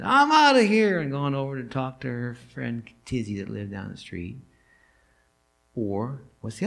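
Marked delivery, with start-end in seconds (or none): 0.0-1.0 shouted high, much louder; door-slamming exit. Retold or imitated. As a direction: yell it as you storm out. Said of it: "slammed the door as loud as she could and said".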